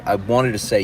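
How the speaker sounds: plain reading voice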